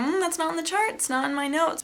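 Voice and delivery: high, airy brush-off as the nurse; imitated dismissive nurse. Nurse turns down the upset patient, mimicked dismissively.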